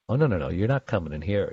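hushed, rapid mock warning-off; immune system talking. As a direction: lightly voice a bouncer-like immune system, softly and fast. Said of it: "your immune system says".

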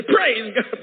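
high, loud burst of praise; imitating an urge to shout praise; retold praise shout. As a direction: blurt it out loudly in worship. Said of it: "I wanted to say".

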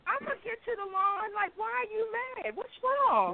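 high, soft, puzzled and innocent; puzzled husband. Retold or imitated. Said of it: "the husband's puzzled reply".

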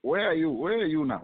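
usual speaking voice

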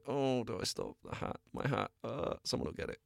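softly voiced quick second thoughts; quiet, dithering aside; hesitant inner voice. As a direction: drop to an under-the-breath debate with yourself. Voicing hesitant thought mid-chase.

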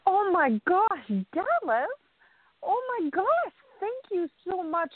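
high, breathless gasps of disbelief; overwhelmed and thrilled, voice jumping high; overjoyed surprise. Real, not acted. Crystal on being invited to the summit, high overjoyed disbelief.

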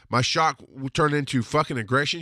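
ordinary talk

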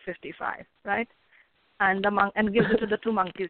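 ordinary delivery

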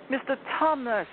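high, loud bawl of a name. A narrator's reading. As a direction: bawl it into his ear. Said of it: "bawled Lucy in his ear".

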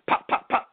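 quick popped gunshot noises; imitated gunshots. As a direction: mimic shots with short unvoiced pops. Imitates gunshots in retold shooting.

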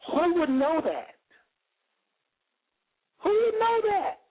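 rising preacher's cry. Acted. Preacher's rhetorical cry to congregation.

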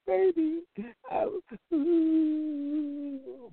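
high whimpering mock sob; mock crying. Man crying to his girl, hammed-up high sob.

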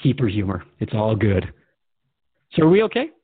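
ordinary talk

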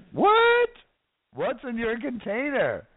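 high squealed disbelief; mock astonishment. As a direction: play up shock in a very high voice. Mock astonishment at own question.

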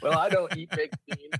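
ordinary talk

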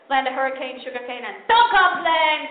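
usual speaking voice

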